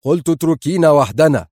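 forceful, agitated dismissal; sharply irritable. A narrator's reading. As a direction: repeat the order with fraying temper. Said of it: "كرر في عصبية حادة".

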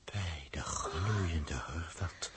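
low, slow whisper; slow hushed whisper; awed whisper. Acted. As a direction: breathe it out in awe. Awed after the blast.

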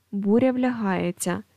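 usual speaking voice

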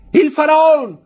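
high, loud shout of great news; joyful shout. A narrator's reading. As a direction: Emmanuel bursts in announcing the ship, shouting. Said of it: "gridò". (grido acuto e forte di gioia)

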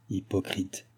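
soft, hushed inner thought. A narrator's reading. Countess thinking of the old woman, hushed aside. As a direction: say it under your breath, to yourself. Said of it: "pense la comtesse".

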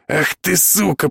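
put-on humiliated boyfriend hissing at Dan. A narrator's reading. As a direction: spit it out through your teeth. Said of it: "прошипел он".

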